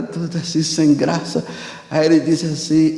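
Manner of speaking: ordinary talk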